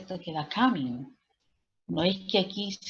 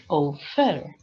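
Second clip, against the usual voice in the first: drawn-out, louder second syllable in offer; wrong stress on offer; 2 semitones lower, 6 dB louder than usual. Shown as a demonstration. Teacher showing what the verb is not, offer stressed on last syllable. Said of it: "el verbo no es".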